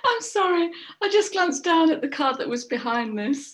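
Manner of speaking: high, loud burst through laughter; real laughing apology. Real, not acted. Card reader apologising after glimpsing the next card, laughing.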